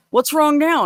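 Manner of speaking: high-pitched, put-upon question; retold passerby, lightly exasperated; mock exasperation. Passerby returning to the crying lady, mock exasperated. Joke's passerby losing patience.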